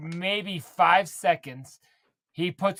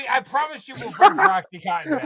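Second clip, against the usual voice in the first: laughing; 9 semitones higher, 4 dB louder than usual. Real, not acted. Apologizing while cracking up.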